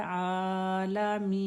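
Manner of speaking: आलमीन with the first आ stretched long, slow. Shown as a demonstration. Teacher showing the standing zabar on आलमीन. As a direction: draw out the आ of आलमीन. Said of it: "आइन को खड़ा ज़बर है".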